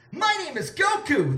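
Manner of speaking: high-pitched heroic character voice; mysterious stranger. A narrator's reading. Mysterious stranger introducing himself to Anne, high put-on voice. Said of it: "the mysterious stranger said".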